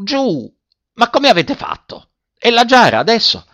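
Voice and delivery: high-pitched, flustered scolding; agitated master crying out, pitched high; exasperated shouting. A narrator's reading. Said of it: "gridò al vecchio".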